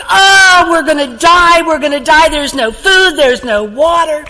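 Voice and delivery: high, loud panicky wail; mock-panic, a man sure he'll die; hammed-up shipwreck panic, fading a little; panicked. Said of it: "one of them was like".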